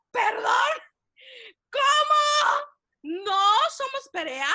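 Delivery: high, loud stunned disbelief; shocked. Retold or imitated. Wife retelling her stunned reaction to the news. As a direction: gasp it out in total shock.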